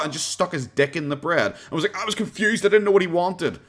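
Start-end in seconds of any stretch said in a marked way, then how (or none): 2.0-3.6 higher-pitched protest of a confused worker; acting out a panicked, helpless excuse; mock flustered excuse. Subway worker excusing himself over the customer's order, mock flustered.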